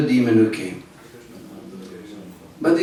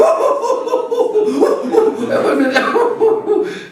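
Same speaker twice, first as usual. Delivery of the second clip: mock boyish cackle; high giggling laughter. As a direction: laugh at him like naughty boys. Boys laughing.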